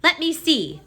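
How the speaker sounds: high, loud crowd of rats; jostling rats. A narrator's reading. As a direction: clamour to see. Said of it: "said the other rats".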